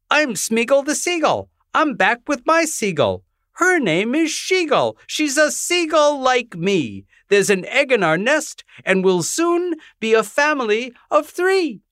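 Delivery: squawky little bird announcing himself, high; Smeagol the seagull. Acted. Smeagol proudly introducing his mate at the door, high bird voice.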